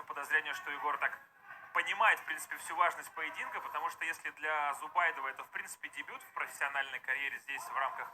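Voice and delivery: normal talking voice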